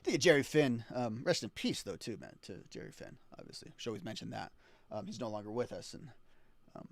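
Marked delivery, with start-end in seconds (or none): 1.8-6.9 dropped to a near whisper; soft, reverent aside; hushed. Real, not acted. Rest in peace tribute.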